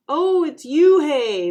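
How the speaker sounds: loud, high, drawn-out mockery; teasing. A narrator's reading. Boy twists the new girl's name, teasing. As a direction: stretch the name out to make fun of it.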